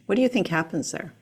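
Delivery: ordinary delivery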